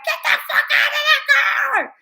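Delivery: laughing mock shout. Him shouting at the woman from the car, laughing mock shout. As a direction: yell it while cracking up. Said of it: "I was like".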